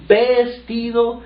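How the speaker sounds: "vestido" stretched slowly, pitched well up; drawn-out stress. Acted. Preacher stresses "vestido" to the congregation, slow and high. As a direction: lean hard on "vestido", draw it out. Preacher dwells on key word.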